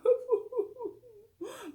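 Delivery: high, loud blubbering laugh; mock sob-laugh. A narrator's reading. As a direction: wail and giggle at once. Laugh-cry after blubbering.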